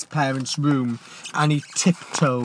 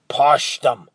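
louder, forceful push on PUSH; a sharp urgent order to the boy; hissed command; 9 dB louder than usual. Acted. Giant ordering Leo at the bars, forceful hiss. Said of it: "hissed the giant".

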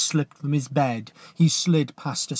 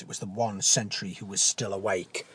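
plain reading voice

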